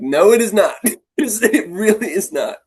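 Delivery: high, loud insistence with a laugh; emphatic protest. Retold or imitated. Coach assuring suspicious students he is no enemy, emphatic protest.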